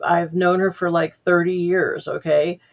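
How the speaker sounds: normal talking voice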